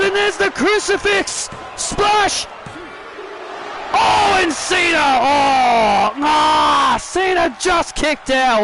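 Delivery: wild celebratory ringside call; hyped play-by-play. Acted. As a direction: scream the upset like a ringside commentator. Live play-by-play of the finish.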